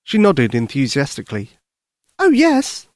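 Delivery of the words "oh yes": put-on high, eager young girl; little girl voice. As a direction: speak like a worried little girl. Lucy answers.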